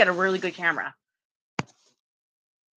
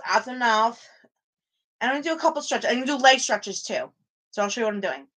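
usual speaking voice

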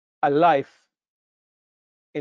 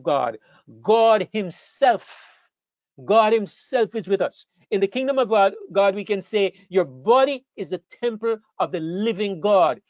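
fervent preaching; 4 semitones higher than usual. Acted. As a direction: lift it up and proclaim it to the congregation. Preaching builds to a fervent peak.

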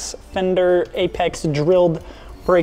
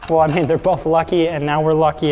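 usual speaking voice

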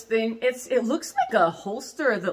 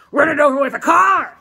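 loud playful shout; 6 semitones higher, 11 dB louder than usual. Real, not acted. Dares viewers to wreck the corset, loud shout. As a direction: yell the dare out loud.

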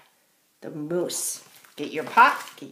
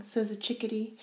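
plain reading voice

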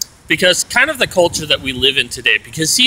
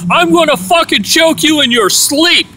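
retold scream; 11 semitones higher than usual. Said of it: "he screamed it out loud".

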